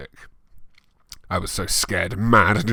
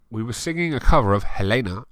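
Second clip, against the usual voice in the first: normal talking voice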